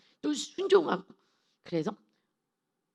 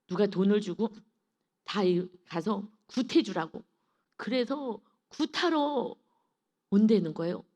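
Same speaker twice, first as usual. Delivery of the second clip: normal talking voice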